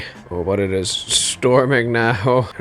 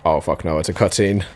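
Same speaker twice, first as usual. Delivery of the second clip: normal talking voice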